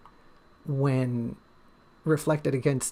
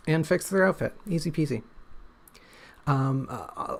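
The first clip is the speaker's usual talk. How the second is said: ordinary talk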